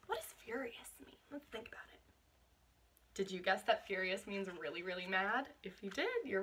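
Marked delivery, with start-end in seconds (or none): 1.3-2.0 whisper. Real, not acted. Teacher prompting children to think.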